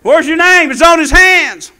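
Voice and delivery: high, loud sermon delivery; full-force preaching. Real, not acted. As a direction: drive it home to the congregation.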